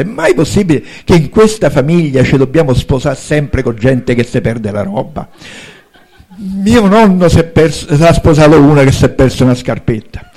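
exasperated princely complaint, bursts of loudness; indignant rant. Acted. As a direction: rant loudly at the family's absurd custom. Prince rants about family marriages. (sfogo indignato)

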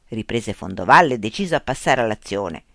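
plain reading voice

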